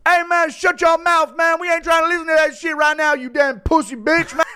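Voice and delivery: put-on high-pitched, rapid kid shouting back; hammed-up bratty kid telling dad off; mouthy kids impression. Chandler's kids answering dad back, high mock tantrum. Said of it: "Little impression of Chandler and his kids".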